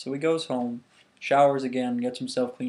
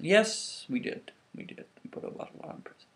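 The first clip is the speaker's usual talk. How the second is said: sheepish admission; 5 semitones higher than usual. Acted. Guards admit the mistake.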